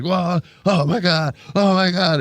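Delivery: put-on high, stretched-out kid's "whoa", half laughing; son's excited yelp. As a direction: play the thrilled boy tossed around in his seat. Twelve-year-old son bounced in seat.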